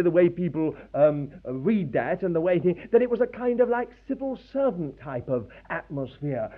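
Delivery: ordinary delivery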